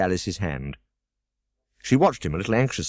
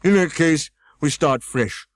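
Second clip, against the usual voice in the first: higher, louder pompous scold; Humpty Dumpty scolding; 8 semitones higher, 5 dB louder, slower than usual. A narrator's reading. Humpty Dumpty scolding Alice, higher and louder. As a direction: snap at the girl from the wall.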